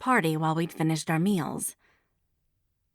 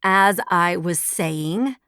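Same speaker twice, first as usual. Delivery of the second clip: slow, pointed As I was saying; pointed emphasis on saying; pointed stress; 4 dB louder, much slower than usual. A narrator's reading. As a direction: lean hard on saying, slowly. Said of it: "she stressed the word pointedly".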